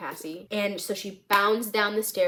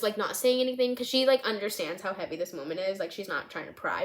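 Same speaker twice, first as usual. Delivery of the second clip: usual speaking voice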